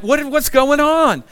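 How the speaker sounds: high, slow, bewildered question; startled confusion. Retold or imitated. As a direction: wake up confused in the dark. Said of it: "I'm like".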